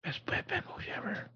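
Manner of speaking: hushed, whispered Batman impression; barely audible, breathy mock Batman whisper; Battinson voice. Co-host mimicking the new Batman, hushed whisper. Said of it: "are we doing our Battinson voices?".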